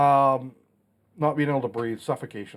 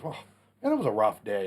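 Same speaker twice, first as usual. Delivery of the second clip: ordinary delivery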